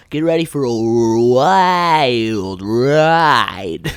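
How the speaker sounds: drawn-out mock announcer build-up; hammed-up hype. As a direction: stretch the words out like a ride intro. Hyped-up intro to stories.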